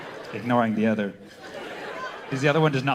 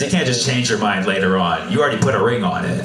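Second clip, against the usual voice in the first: normal talking voice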